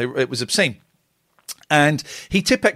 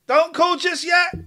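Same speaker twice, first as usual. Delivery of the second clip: high, slower urgent repeat; urgent call-out; 12 semitones higher, slower than usual. Real, not acted. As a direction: stretch it out to hold callers back. Host repeats warning, slower.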